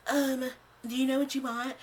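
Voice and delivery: high-pitched mock rude counter lady; bitchy server. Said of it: "the lady at Five Guys was a bitch too".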